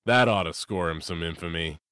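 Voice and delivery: ordinary delivery, nothing marked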